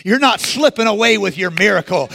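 normal talking voice

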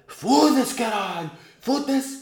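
hammed-up angry outburst; imitated rage. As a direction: replay the kid's tantrum. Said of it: "Reação dele".

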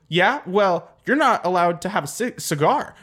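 acted tit-for-tat telling-off; mock indignant retort. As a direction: throw the scolding right back. Passenger retorts angrily in joke.

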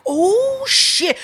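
high loud oh shit; shocked exclamation. Retold or imitated. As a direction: react in loud disbelief. Seeing car towed.

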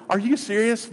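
high-pitched mock disbelief; incredulous. Preacher voicing the congregation's reaction, incredulous. Voices listeners' disbelief at the verse.

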